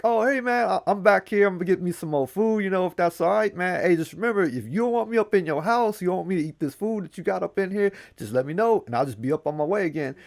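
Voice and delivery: high cheeky call up to the house; Anansi yelling up. Acted. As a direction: shout up to the old man's window. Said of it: "he yells up at him like".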